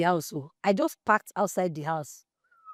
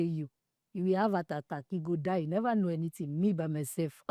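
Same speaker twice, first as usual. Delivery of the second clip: quiet aside; 3 semitones lower, 9 dB quieter than usual. Retold or imitated. Muttering to friend about the guy.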